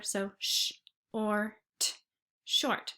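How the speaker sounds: sh, or, t said apart then blended into short; blending sounds. Shown as a demonstration. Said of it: "sounding them out".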